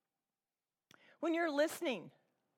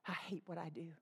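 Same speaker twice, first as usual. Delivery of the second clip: much softer, lower, hushed confession; quiet quote; 7 semitones lower, 12 dB quieter, faster than usual. Retold or imitated. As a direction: say it low like Paul admitting weakness. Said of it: "He says".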